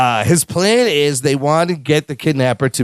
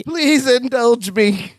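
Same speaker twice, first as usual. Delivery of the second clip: high, slow mock plea; high slow echo; 8 semitones higher, much slower than usual. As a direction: plead it up high, drawn out. Echoing the line.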